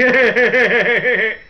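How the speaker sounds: loud, high put-on chuckle; imitated awkward laugh. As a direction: imitate a hillbilly's nervous laughing fit. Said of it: "And he starts laughing".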